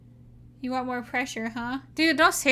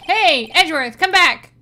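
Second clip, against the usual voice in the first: loud, higher-pitched holler; calling after someone; 5 semitones higher, 12 dB louder, slower than usual. Acted. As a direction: shout after him as he walks off. Calling after him as he leaves.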